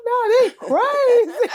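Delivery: high, slow, laughing disbelief. Retold or imitated. Brushing off the fan legions, laughing. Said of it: "I be like".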